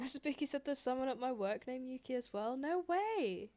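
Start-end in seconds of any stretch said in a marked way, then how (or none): 2.6-3.5 surprised. Real, not acted. Reacting to chat coincidence.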